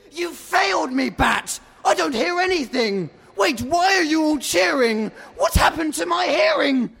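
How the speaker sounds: high, agitated outburst; angry alarm. A narrator's reading. Deafened duck rages, panics.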